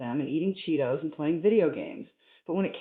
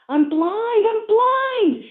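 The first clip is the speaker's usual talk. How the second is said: shrill high scream, much louder; 9 dB louder, much slower than usual. Acted. Prisoner hit in the glasses, screaming high and loud. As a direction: shriek it in panic, pitch way up. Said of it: "he screamed".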